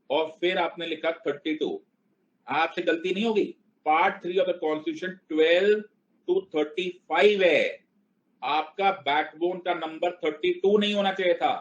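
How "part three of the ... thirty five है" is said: slow emphatic explaining. Shown as a demonstration. Teacher stressing the key point.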